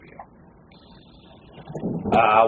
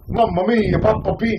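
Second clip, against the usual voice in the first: mock Italian accent; 8 semitones higher than usual. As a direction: ham up a cartoon Italian accent. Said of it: "super fucking, like, stereotypical".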